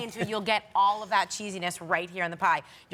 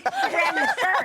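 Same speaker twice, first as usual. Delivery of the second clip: laughing; 4 dB louder than usual. Real, not acted. Laughing tease after pasta flip.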